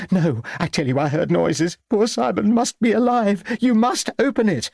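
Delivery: put-on high, urgent old lady's protest; agitated insistence. A narrator's reading. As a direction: insist frantically, pitch climbing high. Old lady insists coffin be opened.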